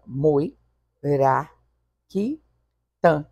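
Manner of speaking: Mui, ra, qui, tan split with clear pauses; teacher counting syllables aloud for the class. Shown as a demonstration. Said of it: "E aí você conta as demais".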